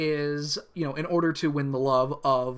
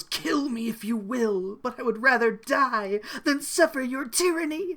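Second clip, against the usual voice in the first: melodramatic defiance; 8 semitones higher than usual. Acted. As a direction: play it as a hammed-up martyr. Melodramatic cartoon line acted.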